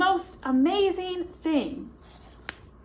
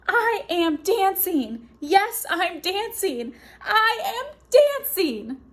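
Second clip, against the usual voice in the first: high, excited shouts of joy; joyful cry; 5 semitones higher than usual. Acted. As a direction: burst out with delight. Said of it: "Gerald cried".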